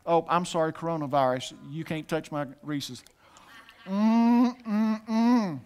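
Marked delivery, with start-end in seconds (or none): 3.8-5.6 a pretend hum of delight over candy; mock savouring hum. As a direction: hum like tasting a treat.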